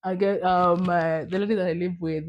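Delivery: usual speaking voice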